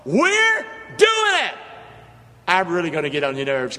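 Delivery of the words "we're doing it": high, loud, drawn-out cry; fired-up preacher shouting; louder call-and-response shout. Real, not acted. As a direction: push the church to top the last one. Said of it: "You can do better than that".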